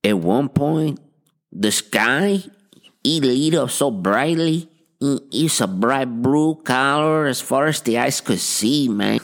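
mock-awed Australian witness; Gary in an Australian accent. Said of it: "Gary said".

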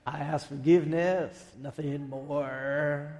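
imitating a country singer, slow and drawn out; country singer twang. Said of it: "Except it sounds like this".

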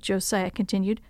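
usual speaking voice

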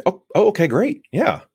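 high-pitched, pleased acceptance; upbeat agreement. Acted. Salesperson role-play after waiting on the buyer, upbeat agreement. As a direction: play a brightly agreeable reaction, pitched well up.

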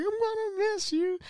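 very high, slow tearful whine; mock crying. Woman bawling as visitor leaves, mock crying. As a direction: wail it in a squeaky put-on sob.

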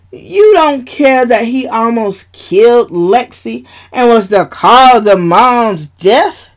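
loud, high, slowing accusation; angry accusation. Acted. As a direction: accuse her with rising anger. Confronting Eva in quarrel.

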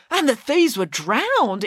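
slow, excited exclamation; a child's triumphant exclaim. A narrator's reading. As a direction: announce it with relish. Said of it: "exclaimed Michael".

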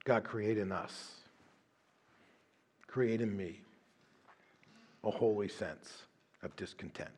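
low, soft, unhurried prayer voice; closing prayer. Acted. Pastor closes the sermon praying over the congregation, hushed and low. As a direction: pray it quietly, with long pauses.

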